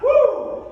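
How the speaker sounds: high, loud "woo"; excited whoop. Real, not acted. Cheering through the workout.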